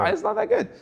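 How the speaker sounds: lightly mocking a sulky actor; mock dismissive. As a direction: wave it off in a silly high voice. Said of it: "I was like".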